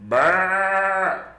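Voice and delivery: high, bleating brrr; sheep bleat. Acted. Sheep tasting grass, gives a high bleat. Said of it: "he said to himself".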